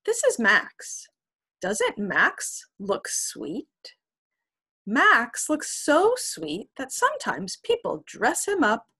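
put-on lively storybook narration for kids; reading to children. A narrator's reading. Teacher reads to children, lively narration. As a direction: read it like to a class of children. Reading a picture book aloud.

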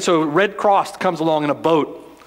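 ordinary talk, nothing marked

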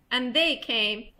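plain reading voice

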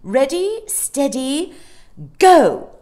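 high, building to a loud shout; race-starter's cry. A narrator's reading. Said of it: "she cried".

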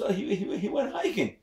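put-on high, halting girl voice; nervous daughter. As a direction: stammer the answer. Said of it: "And she says".

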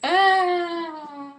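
bursts out laughing, high and loud. Real, not acted. Laughing burst after randomness.

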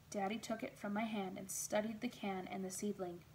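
usual speaking voice

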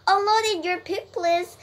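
high, loud public call; loudspeaker announcer. A narrator's reading. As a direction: call it out over the fairground. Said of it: "said the loud, loudspeaker".

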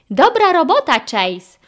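high, loud praise falling at the end; pleased cheer in a storybook voice; delighted. A narrator's reading. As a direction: praise him happily. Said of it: "ucieszył się Ryder".

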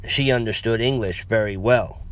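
usual speaking voice

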